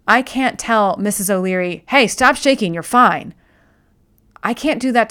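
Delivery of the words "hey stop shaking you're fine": brisk impatient reassurance. Retold or imitated. Telling scared dog to stop.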